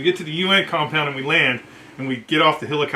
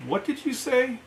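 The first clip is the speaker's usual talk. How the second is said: much higher and softer, disbelieving; incredulous colonel; 9 semitones higher, 5 dB quieter than usual. Retold or imitated. Colonel to the staff sergeant, softly incredulous. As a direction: ask as if you misheard something absurd. Colonel stunned by shelter halves.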